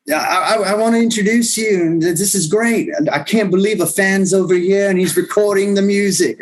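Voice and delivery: playful Swedish-accented impression. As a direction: imitate an excited Swedish musician. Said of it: "That was a great Swedish accent".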